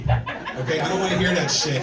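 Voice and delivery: loud, fed-up dismissal; exasperated telling-off. Retold or imitated. Comedian to deluded sports friend, exasperated telling-off. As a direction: shut the bragging down with a burst of volume.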